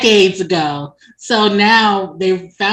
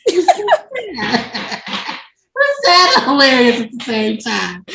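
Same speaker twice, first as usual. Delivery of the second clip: high-pitched words broken by laughter; laughing; 5 semitones higher than usual. Real, not acted. Storyteller finding the sad scene hilarious, laughing. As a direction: laugh helplessly through the words.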